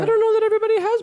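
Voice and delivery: squeaky-high, loud and fast protest. Real, not acted. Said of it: "Why is my voice so high?".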